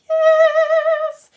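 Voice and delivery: long, very high, loud yes; excited cheer. Real, not acted. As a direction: draw out a delighted squeal. Cheering viewers watching live.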